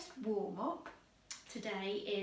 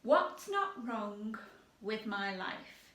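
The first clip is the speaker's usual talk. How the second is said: 1 slow demo repeat; slower than usual. Shown as a demonstration. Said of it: "a bit of a tongue twister".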